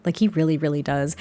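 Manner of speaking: ordinary talk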